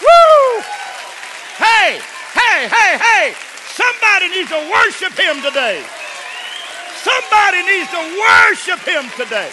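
high, loud shouting exhortation; full-force preaching. Acted. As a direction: shout it out to stir the congregation.

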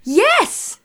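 very high, delighted squeal of agreement; excited yes. Real, not acted. Co-host agreeing eagerly about the accents, excited.

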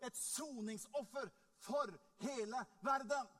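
loud, emphatic sermon climax; full-force preaching. Real, not acted. Preacher proclaiming the sacrifice for the world, building to full force. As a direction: build to a ringing, high final amen.

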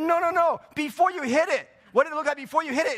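high, loud mock objection; loud protest. Household asking father-in-law about the cat, loud protest. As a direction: cut in loudly to correct him.

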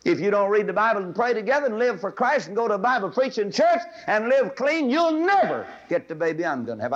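high, loud preaching cry; full-force preaching. Acted. Preacher pleading with girls in the audience, at full force. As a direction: preach it with fire.

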